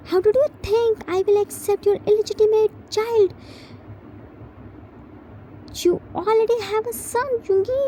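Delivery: high, fast angry outburst; shouting. Acted. Husband shouting at partner about illegitimate child, fast and high. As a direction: hurl the accusation fast and high. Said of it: "Jimin shouted".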